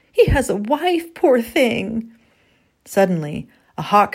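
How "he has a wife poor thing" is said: high, distressed exclamation; shocked wife pitched well above the narration; gasped. A narrator's reading. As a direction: gasp it out in pitying dismay. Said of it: "Eleanor gasped".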